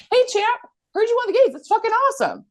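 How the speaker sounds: high-pitched excited hail; acted congratulations. Retelling congratulations to the games winner, high and excited. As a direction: congratulate them high and hyped, as a re-enactment.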